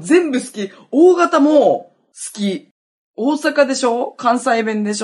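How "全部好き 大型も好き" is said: gushing. Real, not acted. Gushing over her crush's traits.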